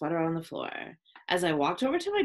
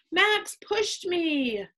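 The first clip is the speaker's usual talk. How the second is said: high, slow, drawn-out complaint; wailing; 9 semitones higher, much slower than usual. A narrator's reading. Chloe telling teacher she was pushed, wailing. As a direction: wail it out as a tattling child. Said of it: "Chloe wailed".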